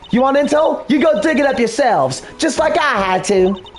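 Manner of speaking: put-on southern accent. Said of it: "like, the, the southern accent".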